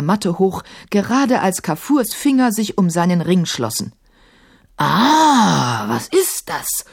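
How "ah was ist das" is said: long drawn roar sinking into a growl; bellowing djinn. A narrator's reading. Sahim the yellow djinn jolted from sleep, bellowing. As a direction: roar awake like a furious giant. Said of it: "gröhlte Sahim".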